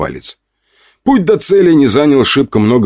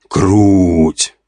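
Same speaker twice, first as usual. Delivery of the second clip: excited. Acted. Amazed at chainsword.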